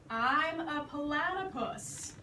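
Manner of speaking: slow, spelled-out insistence; exasperated. Acted. The platypus fed up with George, slow and weary. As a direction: drag each word, fed up. How many times do I have to tell.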